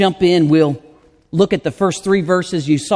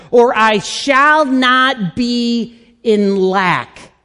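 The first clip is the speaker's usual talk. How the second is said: louder, driving preaching; full-force preaching; 5 semitones higher, 4 dB louder than usual. Acted. Pastor preaching hard on the Lord as shepherd, full force. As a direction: press the point home to the congregation.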